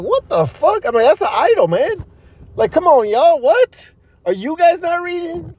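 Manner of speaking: high, incredulous yelps; shocked outburst. Retold or imitated. Cousins posing with the statue, voiced high and shocked. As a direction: act it out as disbelief at the idol. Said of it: "I was like".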